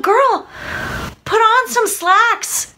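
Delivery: high, drawn-out exasperated plea; mock exasperation. Viewer to Claire in pencil skirts, mock exasperated. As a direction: scold her in a high, slow voice.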